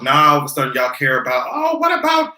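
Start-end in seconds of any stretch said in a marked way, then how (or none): 1.5-2.3 high, whiny mock outrage; mocking whiny critic. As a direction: squeal it like a clueless critic. Mocking people's law and order.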